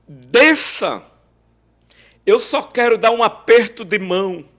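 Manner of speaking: loud and high from the car; calling up. Retold or imitated. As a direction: shout up to the balcony, friendly. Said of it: "ele disse".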